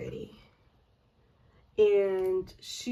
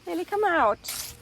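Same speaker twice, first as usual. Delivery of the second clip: coaxing call; 8 semitones higher, 6 dB louder than usual. Real, not acted. Coaxing the dog out.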